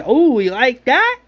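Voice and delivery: slow, high, loud mocking taunt; joking taunt. Shane mocking the walker licking blood, slow loud taunt. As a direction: tease the walker as it licks the blood. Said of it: "Shane jokes".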